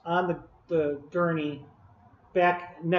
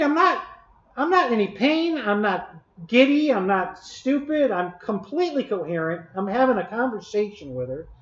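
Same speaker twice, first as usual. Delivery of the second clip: ordinary delivery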